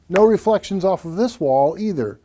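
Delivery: normal talking voice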